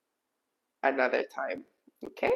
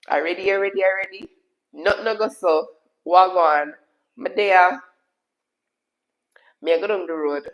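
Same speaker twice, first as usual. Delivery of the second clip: Jamaican patois; 2 semitones higher, 3 dB louder than usual. Shown as a demonstration. Streamer showing viewers the Jamaican accent, in patois. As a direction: lively burst of Jamaican patois. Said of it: "The Jamaican accent".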